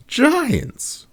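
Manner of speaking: a high, disbelieving one-word question; can't believe what she just heard; incredulous. Acted. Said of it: "Cat asked incredulously".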